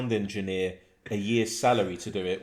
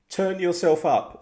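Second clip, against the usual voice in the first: hammed-up message reading; 10 semitones higher, 8 dB louder, slower than usual. Reading James's message aloud.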